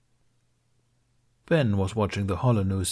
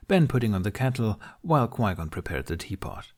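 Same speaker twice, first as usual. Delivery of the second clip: usual speaking voice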